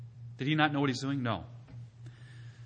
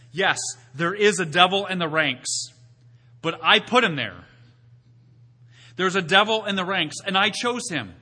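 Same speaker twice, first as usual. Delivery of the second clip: louder, higher preacher's proclamation; preaching; 4 semitones higher, 5 dB louder than usual. Acted. Preacher to congregation, voicing God, proclaiming. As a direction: declare it like God's own voice. Preaching God's words at full force.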